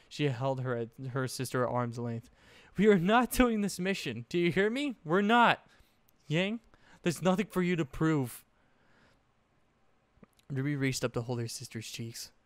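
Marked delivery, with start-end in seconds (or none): 2.8-8.4 much higher, louder frantic pleading; desperate, panicked insistence. A narrator's reading. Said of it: "panic clearly heard in her voice".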